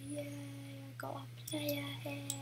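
tiny, drawn-out quiet cheer; soft, slow mock celebration; half-hearted cheer. Player to self on finally getting a head, half-hearted cheer. Underwhelmed cheer at game loot.

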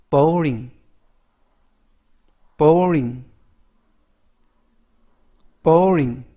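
boring said three times, clear and growing firmer; model pronunciation. Shown as a demonstration. Teacher drilling boring three times, firm and clear. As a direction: repeat boring for learners to copy. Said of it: "이라고 읽으시면 되겠습니다".

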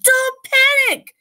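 very high, loud, slow cry; urgent calming shout. Acted. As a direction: leap in to calm her. Chicken interrupts again.

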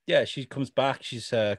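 ordinary talk

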